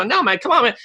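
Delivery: high, teasing no; mock refusal. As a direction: toss it back at him high and mocking. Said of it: "I go".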